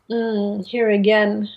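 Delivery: ordinary delivery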